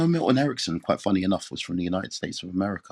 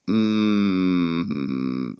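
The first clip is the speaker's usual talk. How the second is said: ordinary delivery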